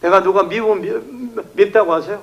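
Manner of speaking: normal talking voice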